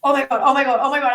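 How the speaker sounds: loud, breathless-style reenacted excitement; retold excited disbelief. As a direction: relive the thrill of unbelievable news. Retelling first sight of being selected.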